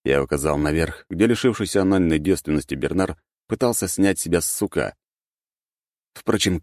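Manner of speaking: ordinary delivery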